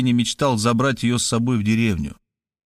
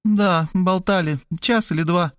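sad; 7 semitones higher than usual. A narrator's reading. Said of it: "грустно согласилась она".

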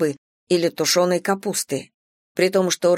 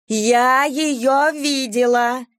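shrieking; 6 semitones higher, much slower than usual. A narrator's reading. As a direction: shriek it like a hysterical neighbor. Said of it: "визжала Валерия".

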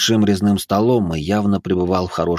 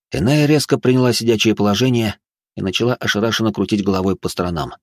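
usual speaking voice

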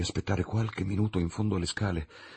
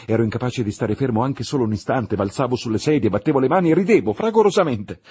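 louder and higher, building frantically; manic excitement; 4 semitones higher, 9 dB louder than usual. A narrator's reading. Victor telling his frenzied fit, building loud and high. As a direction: spill over with feverish glee.

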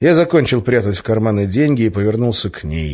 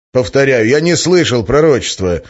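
louder, higher, testy insistence; irritated; 5 semitones higher, 6 dB louder than usual. A narrator's reading. Man pressed about the prophecy, irritated. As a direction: repeat it with clear annoyance. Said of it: "раздражённо ответил я".

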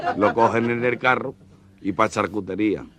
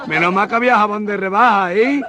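camp voice; 10 semitones higher, 4 dB louder than usual. Acted. Camp character voice continues.

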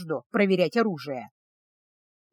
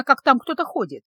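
plain reading voice

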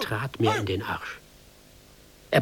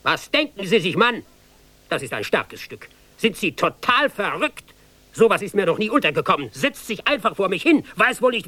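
angry officer; 9 semitones higher than usual. Acted. Officer raging at the seated recruit, shouting. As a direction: rage at the insolent recruit. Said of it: "schrie er mich an".

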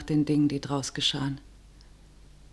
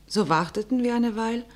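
normal talking voice

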